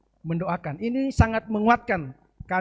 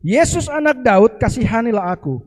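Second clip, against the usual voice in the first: crying out; 8 dB louder than usual. Acted. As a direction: call out loudly, begging for mercy. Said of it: "mulailah ia berseru". (seruan keras memohon belas kasihan)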